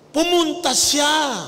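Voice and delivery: slow, loud, high preaching shout; shouted climax. Acted. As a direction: proclaim it at full force.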